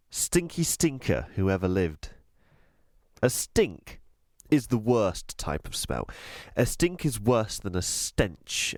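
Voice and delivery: usual speaking voice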